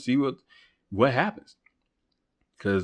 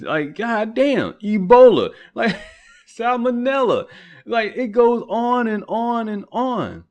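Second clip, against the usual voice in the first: exasperated drawn-out complaint; 9 semitones higher, slower than usual. Real, not acted. Exasperated list of diseases.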